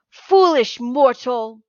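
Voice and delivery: loud slow booming bellow. Acted. Cyclops rises and bellows at Percy. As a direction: roar it like a giant. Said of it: "he bellowed".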